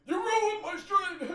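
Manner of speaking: very high, slow, loud whine; high mock wail.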